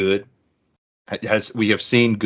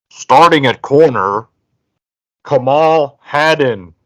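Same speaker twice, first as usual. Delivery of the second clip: booming PA announcer, drawn out; stadium announcer; 5 semitones higher, 7 dB louder, slower than usual. Pretend. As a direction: call the name like a stadium announcer over the speakers. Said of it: "I'm gonna read it like Neyland Stadium's announcer".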